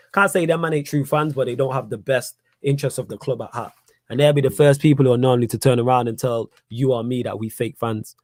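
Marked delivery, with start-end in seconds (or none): none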